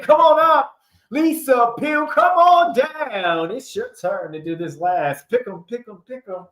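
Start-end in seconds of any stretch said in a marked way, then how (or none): 0.0-3.5 higher, louder host call; hammed-up game show announcer.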